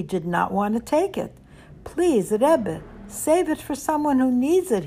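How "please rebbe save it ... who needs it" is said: begging. A narrator's reading. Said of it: "he begged".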